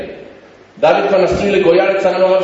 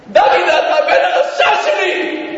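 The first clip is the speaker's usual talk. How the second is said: high, accusing shout; angry reproach; 10 semitones higher than usual. Retold or imitated. Father lashing out at his son, angry reproach.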